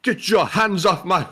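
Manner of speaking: playful echo of a theatrical rant; imitated emphasis. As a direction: parody the overblown protest, breaking into laughter. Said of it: "so much emphasis into that last line".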